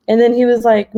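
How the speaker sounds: ordinary talk, nothing marked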